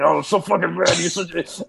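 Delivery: mock angry post. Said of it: "I'm like".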